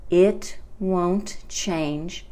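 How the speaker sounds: "It won't change" at half speed; slow model phrase. Shown as a demonstration. Teacher repeating the negative future for learners, slow and deliberate. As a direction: leave gaps between "It", "won't" and "change".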